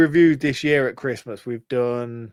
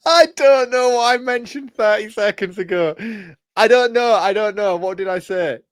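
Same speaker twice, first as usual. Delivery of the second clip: flustered; 8 semitones higher, 5 dB louder than usual. Real, not acted. Flustered guessing, can't answer.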